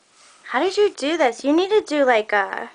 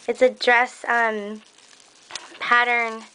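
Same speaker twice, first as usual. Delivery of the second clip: ordinary talk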